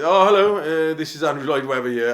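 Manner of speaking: high, lightly posh phone greeting; a hint of a grand composer on the phone; posh caller. Andrew Lloyd Webber phoning him up, lightly posh. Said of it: "he rang me up and he goes".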